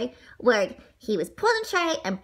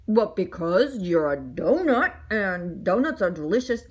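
low, measured grown-up voice; man's matter-of-fact reply; 7 semitones lower than usual. Acted. Mister Bing explains to the donut, low awkward voice.

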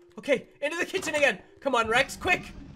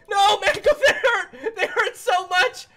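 high, loud, slow laughing yelps; laughing in pain; 8 semitones higher, 8 dB louder, slower than usual. Real, not acted. Player yells at Mango while getting hurt, laughing. Being hit by animatronics.

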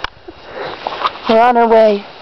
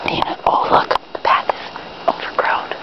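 soft documentary whisper; 6 dB quieter than usual. Pretend. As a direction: whisper like a wildlife host hiding.

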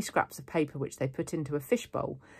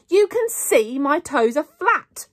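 high, slow, emphatic yell; 12 semitones higher, 10 dB louder, slower than usual. A narrator's reading. Girl proving her toes aren't crossed, yelling. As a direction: yell each word in exasperation. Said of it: "I yelled".

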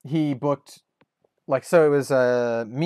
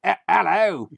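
bad Cockney hello, higher and louder; terrible Cockney; 7 semitones higher, 5 dB louder than usual. Shown as a demonstration. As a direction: overdo a Cockney greeting. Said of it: "the terrible, like, Cockney".

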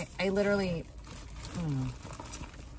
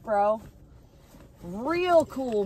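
usual speaking voice

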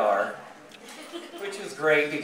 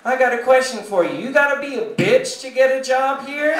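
higher, loud challenge; confrontational comeback; 9 semitones higher, 5 dB louder than usual. Acted. Patient standing up to the desk lady, confrontational. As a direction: confront her, building to a high question. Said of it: "I get up and I say".

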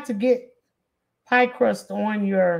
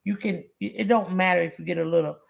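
ordinary talk

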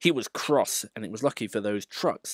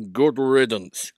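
slow, louder grumble; grumbling Gordon; 3 semitones higher, 6 dB louder, slower than usual. Acted. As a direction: grumble it out slowly. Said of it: "grumbled Gordon".

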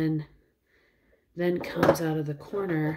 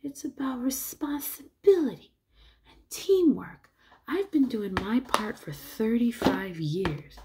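Whitey; 8 semitones higher than usual. Retold or imitated. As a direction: deliver a lofty pep talk in a high put-on voice. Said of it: "I remember where he goes".

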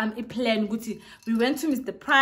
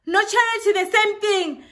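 mock outraged retort; 11 semitones higher, 8 dB louder than usual. Girls confront the cheater.

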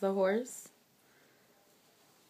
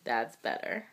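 ordinary talk

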